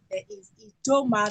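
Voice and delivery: ordinary delivery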